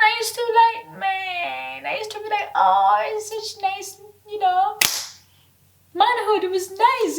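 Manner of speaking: high, dreamy mimicry; high airy mock of her old idealism; mock naive voice. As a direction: swoon over it like a naive girl. Mocking her former naive view.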